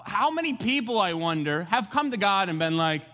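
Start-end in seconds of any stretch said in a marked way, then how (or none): none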